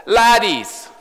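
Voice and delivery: cheeky teasing call from the shore; cheeky laddies. Acted. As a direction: tease the young fishermen like a fond friend. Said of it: "captures the cheekiness".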